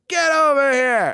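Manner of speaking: loud, high, stretched beckoning shout; imitated hearty summons; calling out. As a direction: holler to wave a pal over. Star beckons him over.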